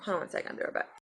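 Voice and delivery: whispered aside, voice off; quick unvoiced whisper to the viewers; hushed whisper. Real, not acted. Baby acting up nearby.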